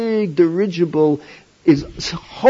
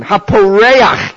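haporeach stretched out slowly and a bit louder; savoured word; 3 semitones higher, 6 dB louder, much slower than usual. Shown as a demonstration. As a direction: draw out haporeach with relish. Dwells on the rabbinic word.

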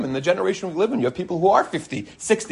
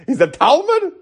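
incredulous question; 13 semitones higher than usual. Shown as a demonstration. Rabbi mocking calling a sage a student, incredulous high "Talmud?". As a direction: scoff at the word "Talmud" as absurd. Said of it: "what are you talking about?".

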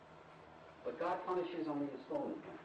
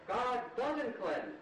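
doesn't hit hard, very slow, higher and louder; emphatic teaching; 9 semitones higher, 6 dB louder, much slower than usual. Shown as a demonstration. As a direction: drag out God doesn't cleanse, punching doesn't. Teacher hammering home the verse.

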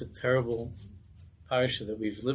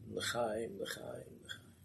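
soft toast; 9 dB quieter, much slower than usual. Acted. Rebbe softly toasting.

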